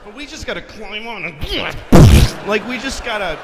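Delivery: ranting at the crowd, building up; loud comic rant; rising rant. Acted.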